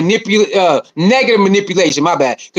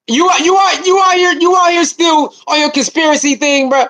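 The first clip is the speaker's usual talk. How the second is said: raised voice; 5 semitones higher than usual. Real, not acted. Mocking streamer calling out chatter.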